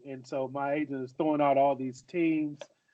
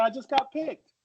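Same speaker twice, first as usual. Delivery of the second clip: much higher, lifted on "got"; insistent repeat; 9 semitones higher than usual. Retold or imitated. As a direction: say it again, pushing "got" up high so they finally hear. Repeats it after everyone asks what.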